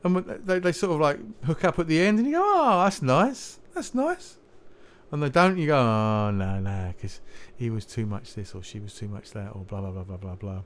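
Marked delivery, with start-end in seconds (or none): 2.4-4.4 high, drawn-out mock delight; imitating a delighted viewer; pleased cooing. Acting out pleased viewer of dating show. 5.8-10.6 low, soft let-down grumble; mildly acted disappointment, trailing into softness; mock disappointment. Acting out let-down viewer, contrasting delight.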